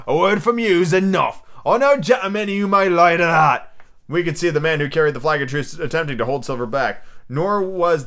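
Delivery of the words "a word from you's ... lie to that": high, louder pirate voice; cheery shout. A narrator's reading. Long John accepting the captain's word, cheery shout. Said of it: "shouted Long John cheerily".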